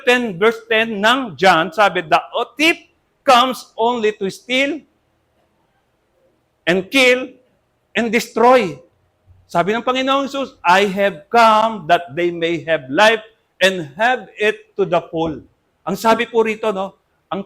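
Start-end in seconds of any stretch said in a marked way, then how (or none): 2.1-8.8 slow, louder reading of the verse; slow scripture reading. Acted. 10.6-15.4 slow, louder reading of the verse; slow scripture reading. Acted.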